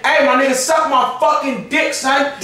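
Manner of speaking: mock New York accent. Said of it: "I know how to do the New York accent".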